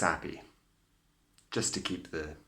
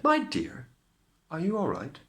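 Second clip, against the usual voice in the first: high fairy voice; 10 semitones higher than usual. A narrator's reading. Fairy godmother asks Cinderella if she's all right. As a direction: lilt it up like a fairy. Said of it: "she said".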